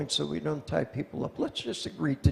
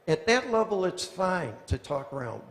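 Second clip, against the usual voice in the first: ordinary delivery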